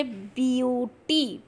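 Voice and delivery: Beauty said slowly and drawn out; slow model read. Shown as a demonstration. Teacher slowly modelling beauty for the class. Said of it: "teacher modelling the word".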